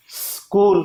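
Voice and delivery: اسکول said as skool, no vowel before the s; careful Urdu skool; correct school. Shown as a demonstration. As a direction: start اسکول straight on the s cluster. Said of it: "حالانکہ سین ساکن ہے".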